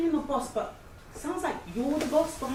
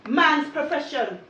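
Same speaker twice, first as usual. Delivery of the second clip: imitating mum; 4 semitones higher, 5 dB louder, slower than usual. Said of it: "but mum said".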